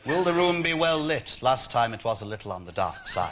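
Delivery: a hint of a fussy old Jedi; Obi-Wan Kenobi impression. Prank caller as Obi-Wan asking the hotel about lighting. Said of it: "Obi-Wan Kenobi".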